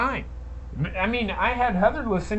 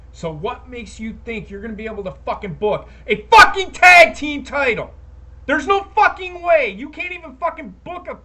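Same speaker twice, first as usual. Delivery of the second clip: loud furious rant, peaking on "tag team title"; angry yelling; 3 semitones higher, 9 dB louder than usual. Real, not acted. Ranting at the McMahons about the tag titles, yelling. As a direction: yell it straight at the bosses. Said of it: "I'm yelling at fucking the McMahons".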